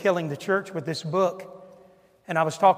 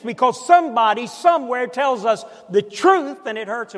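louder, higher, driving sermon rhythm; preaching at full force; 4 semitones higher, 7 dB louder than usual. Acted. Preacher firing up the congregation in full-force preaching. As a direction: hammer each "in and out of" home. Preacher builds a fiery list.

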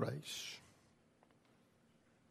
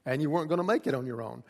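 ordinary delivery

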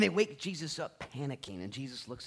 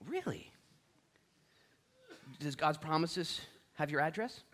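usual speaking voice

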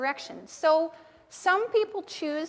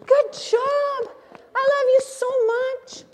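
high and loud, cheering Good job; praising aloud; 10 semitones higher, 7 dB louder, slower than usual. Shown as a demonstration. Teacher leads children in praising themselves loudly. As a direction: cheer yourself on. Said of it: "Just say".

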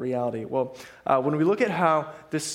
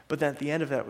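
ordinary delivery

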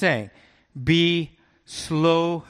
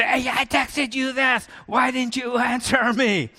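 higher, hammed-up mad complaint; mock angry text; 7 semitones higher than usual. Pastor imagining snapping at Chet, mock angry and high. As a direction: play at getting mad, voice high. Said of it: "get mad and say".

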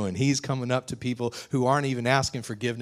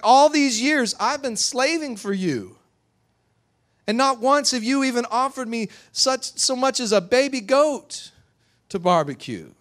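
high, loud aggrieved complaint; furious; 13 semitones higher, 6 dB louder than usual. Acted. Older son complaining of slaving for his father, furious. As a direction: pour out years of resentment. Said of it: "And now he's furious".

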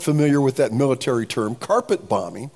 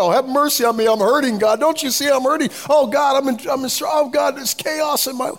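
high, hammed-up pleading, tumbling on; mock frantic prayer; 10 semitones higher, 3 dB louder than usual. Preacher mimicking people throwing prayers at God, mock frantic pleading. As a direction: wail a scattered prayer in mock distress.

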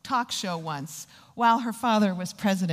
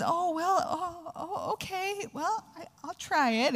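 high, soft, faltering imitation; retold hesitant agreement; 8 semitones higher, 4 dB quieter than usual. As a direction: stall timidly in a small raised voice. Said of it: "And Jenna says".

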